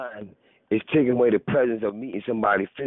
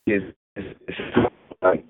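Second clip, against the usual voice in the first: cutting in and out on a call; breaking-up phone line; 4 dB louder than usual. Real, not acted. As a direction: voice dropping out over the phone. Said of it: "now it's going back in and out again".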